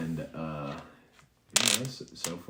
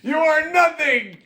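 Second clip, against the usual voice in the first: high, loud sneering dwarf voice; sneering dwarf taunting Yarner, loud and high; hostile dwarf; 12 dB louder than usual. Acted. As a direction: spit scorn at him from up high.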